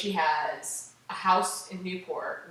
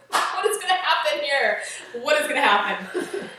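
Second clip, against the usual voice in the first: laughing through a baffled question, louder; laughing disbelief; 9 semitones higher, 9 dB louder than usual. Retold or imitated. Telling a friend about the dinner, laughing incredulously. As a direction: giggle at how strange this is.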